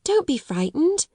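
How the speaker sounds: put-on high, gentle comfort to a scared girl; reassuring. A narrator's reading. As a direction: soothe her like a friend. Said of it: "as if speaking to a little girl".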